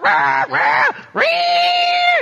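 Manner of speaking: loud mock siren wail rising very high; vocal siren squawks into a high wail; police siren imitation. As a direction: imitate a squad car siren, last wail held high. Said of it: "the siren and the whole thing".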